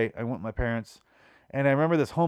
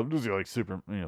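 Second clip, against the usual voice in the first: ordinary talk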